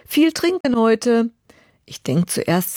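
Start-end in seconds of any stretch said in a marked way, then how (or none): 0.1-1.3 higher, slower imitated call. Young neighbour to woman on the stairs, imitated calling out. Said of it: "sprintet an mir vorbei die Treppe rauf und ruft".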